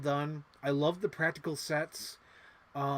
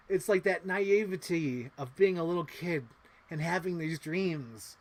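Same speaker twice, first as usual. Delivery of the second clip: ordinary talk, nothing marked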